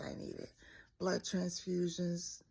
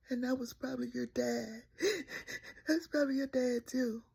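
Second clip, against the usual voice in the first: retold sobbing; 5 semitones higher, 3 dB louder than usual. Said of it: "she's boo-hooing and she said".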